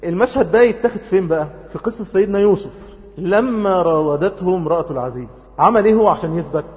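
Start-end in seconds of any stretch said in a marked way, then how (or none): none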